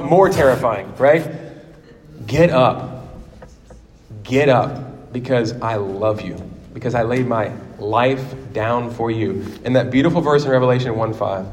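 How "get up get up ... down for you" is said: quiet, tender urging, sinking lower; softly spoken words of love; less salty plea. Acted. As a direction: urge gently, growing softer and lower. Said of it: "in a less salty version".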